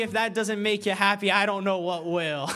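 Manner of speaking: loud, high Southern twang bursting out; sudden Southern-accented cheer; Southern exclamation. Real, not acted. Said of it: "I'm not Southern".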